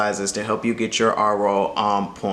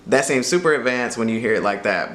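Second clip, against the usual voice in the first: usual speaking voice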